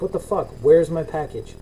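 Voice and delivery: ordinary delivery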